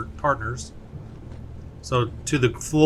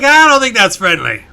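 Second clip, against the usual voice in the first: mock worried voice; 6 semitones higher, 10 dB louder than usual. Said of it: "You're like".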